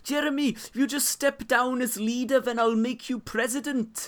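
high, singsong Welsh accent impression. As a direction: put on a broad Welsh lilt, pitched high. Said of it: "I'm gonna do the Welsh accent now".

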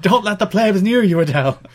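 put-on security guard voice; hammed-up guard barking orders; mock bouncer voice.

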